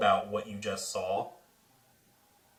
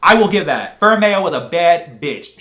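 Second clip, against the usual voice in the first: loud, slow, punched-out hype; booming, drawn-out admiration; hyped praise; 6 semitones higher, 15 dB louder, slower than usual. Real, not acted. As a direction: belt out each word, thrilled. Hyped praise right after the climax.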